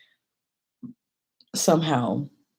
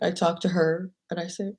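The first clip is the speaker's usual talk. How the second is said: ordinary talk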